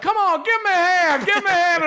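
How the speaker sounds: high, hammed-up emcee begging for applause; hype-man host. Club host begging the crowd to applaud the comic, high hammed-up emcee call.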